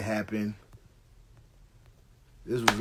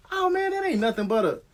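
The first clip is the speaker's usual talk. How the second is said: much higher, choked-off exclamation; startled partner; 4 dB louder than usual. Retold or imitated. Partner stunned as the light vanishes, high and cut off. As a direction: gasp it out and stop short. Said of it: "he couldn't even get it out".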